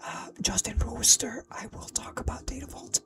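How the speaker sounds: breathy whisper, voice switched off. Real, not acted. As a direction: keep whispering softly. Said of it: "unless I talk like this".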